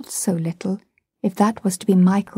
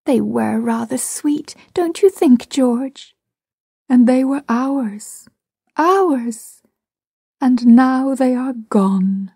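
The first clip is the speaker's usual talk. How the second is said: wistful grief; 4 semitones higher, slower than usual. A narrator's reading. Mother mourning lost children.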